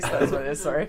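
usual speaking voice

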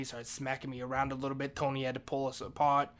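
ordinary talk, nothing marked

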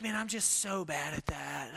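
low, soft, fake-modest groan; mock self-pity. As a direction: mumble it like fishing for compliments. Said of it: "you'll be like".

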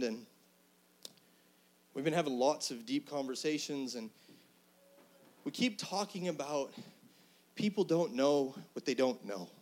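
ordinary delivery

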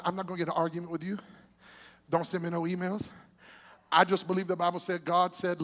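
ordinary talk

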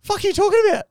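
high, loud "what are you on about" yell; exasperated yell. Retold or imitated. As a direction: blurt it out, baffled and fed up. Tim exasperated, retold.

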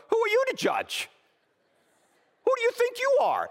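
very high, loud indignant squeal; mock offended friend. Preacher mocking a friend offended by compliments, high indignant squeal. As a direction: squeal it like an outraged prima donna.

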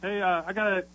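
ordinary delivery

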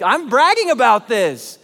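loud, showy boast; arrogant bragging. Acted. Imagining himself as risen Jesus, loud bragging. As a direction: boast it at full volume to the room. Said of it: "He's not arrogant".

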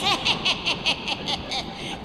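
witch cackle. Pretend. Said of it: "can we get a witch laugh for the podcast".

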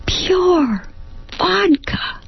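Pure vodka squeaked very high, vodka falling; shocked realisation. Acted. She realises the glass is pure vodka, squeaking very high. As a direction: let the shock leap up.

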